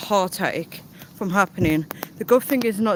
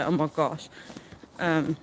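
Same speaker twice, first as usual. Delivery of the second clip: ordinary talk, nothing marked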